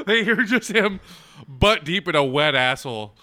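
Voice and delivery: ordinary talk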